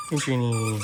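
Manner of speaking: excited greeting. Real, not acted.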